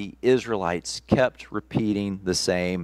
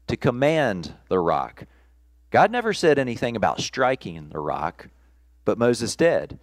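usual speaking voice

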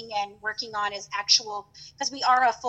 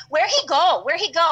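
higher, louder insistent broken English; the suspicious shopkeeper demanding where he went; mock accented shopkeeper, demanding; imitating shop lady's accent; 4 semitones higher, 5 dB louder than usual. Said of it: "she's like".

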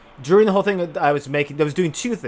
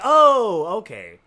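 high, loud "oh", then a dropped "okay"; sudden realization; 7 semitones higher, 4 dB louder, much slower than usual. Retold or imitated. Roommate recounts reacting to his friend's text, mock realization.

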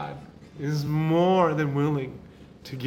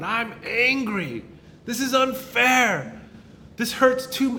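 higher, slow, pained lament; voicing raw complaint; 9 semitones higher, 4 dB louder, slower than usual. Pastor voicing a hurting believer's prayer, slow and pained. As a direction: cry it out to God, slowly.

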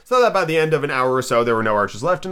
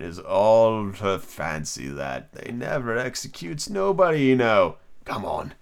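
slow, drawn-out storybook creature; drawling, amused beast; Griffin character voice; 3 semitones lower, slower than usual. Acted.

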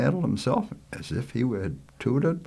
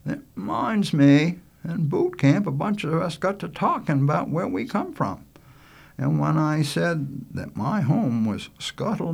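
lightly voiced yarn-spinner, higher; a hint of a folksy storyteller; reminiscing Lester; 9 semitones higher than usual. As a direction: begin a boot camp tale at leisure. Lester starts a tale.

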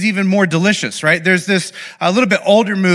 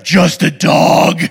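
outraged shout; 4 dB louder, much slower than usual. Retold or imitated. John Wick answering dismissive thugs.

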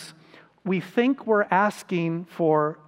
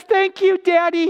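put-on much higher, louder little boy; mock child thanking his daddy; 10 semitones higher, 5 dB louder than usual. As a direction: squeak it like a trusting small child. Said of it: "the little boy says".

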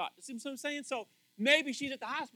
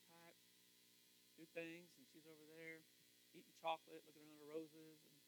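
usual speaking voice

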